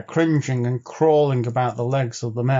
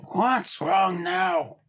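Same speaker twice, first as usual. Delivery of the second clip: very slow, higher, just-woken voice; groggy demand; 7 semitones higher, much slower than usual. A narrator's reading. Henry woken by Bill in the night, groggy and slow. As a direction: drag it out of sleep. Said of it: "Henry groaned ... and demanded".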